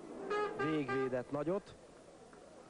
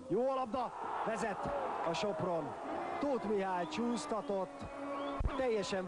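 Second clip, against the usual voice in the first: excited goal call; 8 semitones higher than usual. Real, not acted. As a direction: sell the goal like it's huge. Commentator calls the opening goal.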